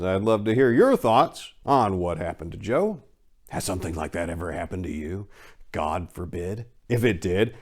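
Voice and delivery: plain reading voice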